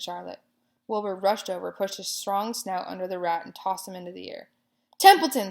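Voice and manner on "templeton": high, loud scream of a name. A narrator's reading. Pig screams at sleeping rat. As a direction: yell him awake. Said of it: "screamed Wilbur".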